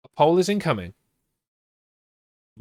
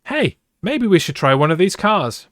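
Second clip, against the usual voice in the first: eager character suggestion; 6 semitones higher, 4 dB louder than usual. A narrator's reading. Brad's suggestion in story.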